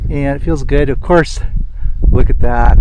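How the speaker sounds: ordinary talk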